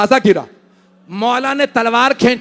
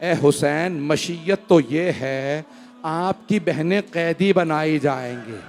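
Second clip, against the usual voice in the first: hushed, much softer grieving narration; soft mournful recitation; 5 semitones lower, 6 dB quieter than usual. Acted. Preacher reciting the farewell at the Prophet's grave, hushed and mournful. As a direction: draw the mourners in close with quiet grief.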